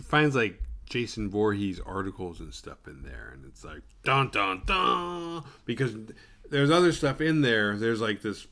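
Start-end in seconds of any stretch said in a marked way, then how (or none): 4.0-5.4 dramatic sting. Retold or imitated. Dramatic reveal sting sound.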